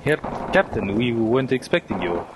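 grave German officer voice; brief the men in German accent. Acted. German officer sketch dialogue.

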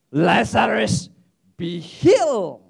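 loud, drawn-out healing command; commanding shout. Acted. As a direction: call it out slowly with full force. Second take, Jesus commanding a healing.

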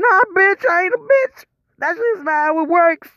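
very high, squeaky outrage; high-pitched indignant retort. Retold or imitated. Man shrieking at his partner that it's wrong. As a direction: shriek it back in disbelief.

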